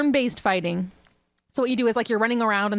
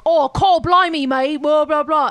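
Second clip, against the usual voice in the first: loud, high, thick Cockney impression; Cockney accent; 6 semitones higher, 8 dB louder than usual. Describing the game's sidekick to friends, loud thick Cockney. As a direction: ham up a thick Cockney like Yengus. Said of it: "That was a great Cockney accent".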